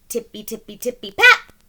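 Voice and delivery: tiptoe, then land the pat; hopping sound. Acted. Bunnies sneaking up, tiptoeing then a loud pat.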